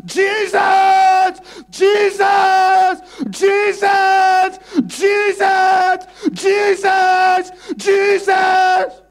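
long, high, loud desperate cries; blind beggar crying out. Retold or imitated. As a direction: call out again and again as if your life depends on it. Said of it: "this man kept crying".

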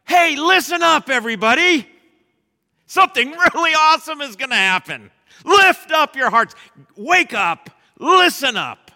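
high, loud rallying shout; excited shout. Pretend. Preacher rousing the congregation awake, shouting high and loud. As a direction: rouse the whole room awake. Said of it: "I wanna just like".

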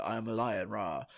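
lightly voiced low cartoon lion; low, understated announcement with a token roar; mock lion. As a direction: imitate a creepy toy lion. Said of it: "this lion on wheels just wheels out and goes".

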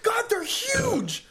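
high, drawn-out amazed exclamation; astonished. Real, not acted. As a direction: marvel in a stretched, soaring high pitch. Reacting to the size of peanuts.

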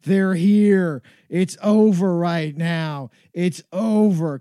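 sudden panicked oh no, then a slow deflated it's over; the party's ruined, give up; mock dismay. Clubgoer seeing the hot people arrive, mock despair. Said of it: "you're like".

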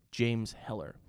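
ordinary delivery

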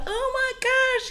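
high-pitched mock swoon; imitated girlish thrill; retold swooning. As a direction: gush like a smitten teen, played up. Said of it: "I thought their accent was just it".

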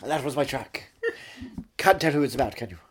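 ordinary talk, nothing marked

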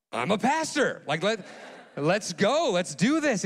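high, eager declaration; rally them, raring to go; excited volunteering. Retold or imitated. Pastor volunteers to the pilot for the mountain church, excited.

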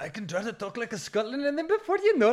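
put-on high, loud, jokey Indian accent slipping in; mock Indian accent. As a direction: ham up a slide into an Indian accent. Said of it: "all of my accents regress to an Indian accent".

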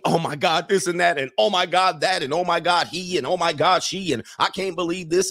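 mock-scandalized girl talk; mock women's chatter. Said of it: "and then they start talking".

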